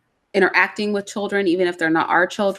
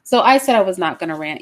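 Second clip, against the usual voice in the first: ordinary delivery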